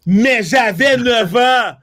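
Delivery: high, loud, drawn-out protest; indignant shout. Retold or imitated. Storyteller protesting he was only nine against a huge father, indignant shout. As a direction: stretch each word out in a comic yell.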